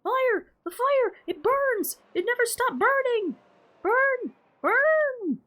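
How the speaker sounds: high, loud frantic screams about fire; hysterical screaming. Acted. Tarba screaming hysterically about the fire, high and loud. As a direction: scream in terror at the flames. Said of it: "as Tarba screams hysterically".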